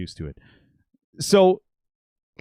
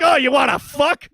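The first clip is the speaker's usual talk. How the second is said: high, loud, slow threat; challenging; 14 semitones higher, 9 dB louder than usual. Retold or imitated. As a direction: pick the fight. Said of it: "he's like".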